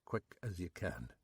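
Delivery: quietly. Acted. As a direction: say it quietly aside. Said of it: "he said quietly".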